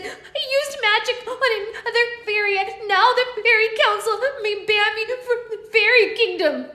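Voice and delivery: fairy explaining her magic mishap; tiny fairy voice. Acted. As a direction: blurt it out anxiously. Fairy confessing.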